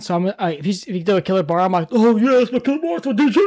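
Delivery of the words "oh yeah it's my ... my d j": hype man. Pretend. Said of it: "I want to be your hype man".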